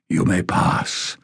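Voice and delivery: slow, rasped grant of passage; a hint of a hoarse sentinel; rasping guardian. A narrator's reading. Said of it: "in a low, rasping voice".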